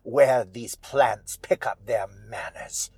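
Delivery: put-on theatrical old widow, disdainful; Old Widow Holcomb. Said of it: "Holcomb says".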